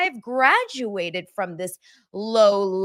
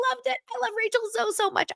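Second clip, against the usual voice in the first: high, gushing delight; 3 dB quieter, faster than usual. Real, not acted. Host raving about Rachel's return, gushing high. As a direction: swoon over it.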